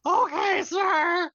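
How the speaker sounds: put-on young adjutant's breaking voice. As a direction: squeak it out like a nervous seventeen-year-old. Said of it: "make him seventeen and his voice still breaking".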